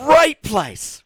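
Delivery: "great" thrown up high and loud; mock enthusiastic. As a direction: gush over the old club. Nostalgic about the nightclub.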